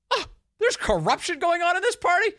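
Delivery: high, loud hammed-up alarm; mock Democrats. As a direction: act like a scandalized party member. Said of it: "They've said".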